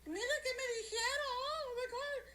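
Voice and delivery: very high, shocked Spanish-tinged shriek with a laugh; shrieking offended woman. Retold or imitated. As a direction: shriek in mock outrage. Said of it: "And they'll be like".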